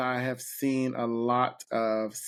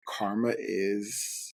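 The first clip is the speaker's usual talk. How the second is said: knowing quote; 4 semitones lower, much slower than usual. Retold or imitated. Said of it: "people ultimately are like".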